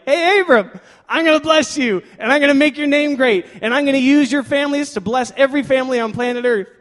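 high, loud mock-divine announcement; calling out. God shows up promising Abram blessings, calling out loudly. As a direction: holler it out like a grand promise.

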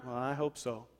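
soft, doubtful mutter of "hope"; sarcastic hope. Shown as a demonstration. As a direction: mumble it like you don't believe it. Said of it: "That's sarcastic hope".

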